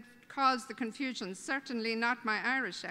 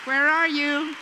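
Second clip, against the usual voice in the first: even louder repeated call, stressing ARE; 10 dB louder than usual. Real, not acted. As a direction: shout it out, still searching for her. Calling Nadine again, no answer.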